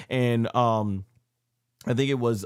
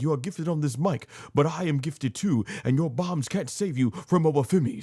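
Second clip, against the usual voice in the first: imitating Oba Femi's accent; 5 semitones higher than usual. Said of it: "I can't do the accent".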